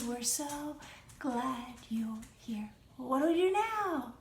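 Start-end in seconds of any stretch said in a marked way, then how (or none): 0.1-2.7 whispered line, lower. Shown as a demonstration. Teacher whispers the song's end to the class. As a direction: hush it down to a whisper.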